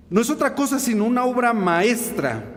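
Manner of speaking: usual speaking voice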